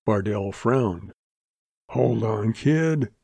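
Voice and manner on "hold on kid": plain reading voice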